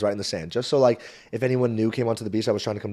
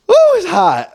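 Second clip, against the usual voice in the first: high, loud exclaim; getting worked up retelling the beach fight; 12 dB louder, slower than usual. Real, not acted. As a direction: let the heat of the story boil over. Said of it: "I'm getting worked up".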